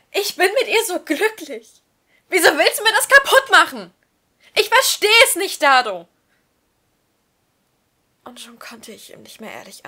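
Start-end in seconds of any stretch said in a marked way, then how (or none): 0.1-6.1 high, loud, hurt crying out; anguished outburst. Acted. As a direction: shout the hurt at a friend. Manu confronts Dado, upset.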